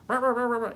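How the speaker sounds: high, loud rah rah noise imitating a wild serpent; mock creature noise. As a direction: bark out a playful monster noise.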